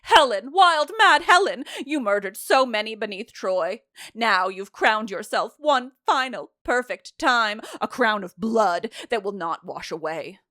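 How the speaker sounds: loud, declamatory accusation; theatrical denunciation; chorus quote. Acted.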